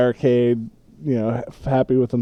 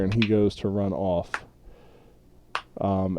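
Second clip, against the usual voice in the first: normal talking voice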